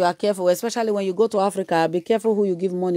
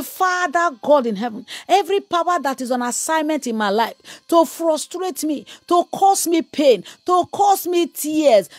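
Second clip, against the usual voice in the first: raised, intense preaching; fiery prayer leading; 6 semitones higher than usual. Acted. Preacher leading the church in prayer, fervent. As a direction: lead the congregation in prayer with fire.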